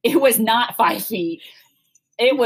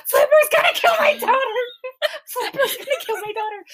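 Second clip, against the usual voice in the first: panicked mom yell. Retold or imitated. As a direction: shriek it like a terrified mother, cracking into a laugh. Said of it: "And I'm over there".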